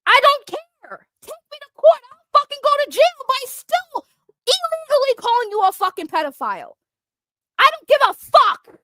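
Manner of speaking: angry shouting. Real, not acted. Ranting at his critics on the platform, furious shout. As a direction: blast it out in real anger. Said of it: "I'm angry".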